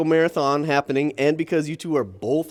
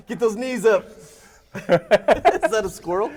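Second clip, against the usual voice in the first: chuckling through the words; laughing; 6 semitones higher, 5 dB louder than usual. Real, not acted. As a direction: laugh while you cheer them on. Laughing while coaching.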